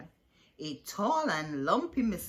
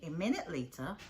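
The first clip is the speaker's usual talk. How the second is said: ordinary delivery, nothing marked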